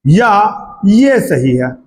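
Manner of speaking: usual speaking voice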